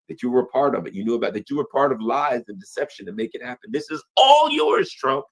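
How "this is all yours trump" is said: emphatic. Real, not acted. Ranting at Trump over the war.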